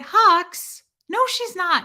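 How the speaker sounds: mock outraged denial. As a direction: retell your own indignant shout, playfully. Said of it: "And I said".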